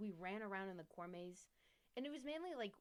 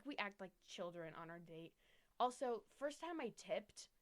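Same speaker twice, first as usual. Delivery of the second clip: usual speaking voice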